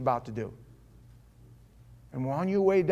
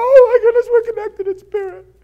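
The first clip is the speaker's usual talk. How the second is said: very high, loud comic wail; mock crying; 13 dB louder than usual. Preacher imitates crying wife at magic trick, mock wail. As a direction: imitate a woman bawling at full pitch. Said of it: "She's crying".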